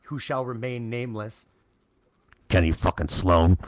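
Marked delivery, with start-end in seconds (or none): 2.5-3.6 low, loud naming of the culprit; loud call-out. Real, not acted. Paintballer exposing who messed with his settings, loud call-out. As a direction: boom the name out like an accusation.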